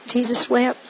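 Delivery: ordinary delivery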